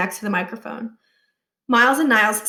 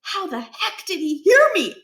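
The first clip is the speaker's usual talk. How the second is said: put-on surprised boy; 8 semitones higher than usual. A narrator's reading. Stuart is startled.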